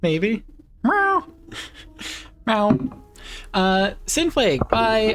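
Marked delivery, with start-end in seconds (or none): 0.8-2.8 very high squeaky meows; high cat meow. Pretend. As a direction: call out like a kitten. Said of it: "Meowing brought out all of the kitties".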